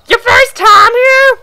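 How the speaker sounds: high, slow, loud squawky voice; strained high crow voice; crow-like pioneer voice. Acted. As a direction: make him sound like a crow. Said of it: "before, I was trying to give him, like, an Irish accent".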